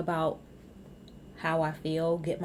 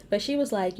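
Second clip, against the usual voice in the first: normal talking voice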